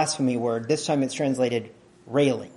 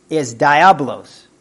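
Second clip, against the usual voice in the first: "diablos" said slowly and louder, stretched out; drawn-out reveal; 8 dB louder, much slower than usual. Real, not acted. Preacher springs the Greek word on the congregation, slow and loud.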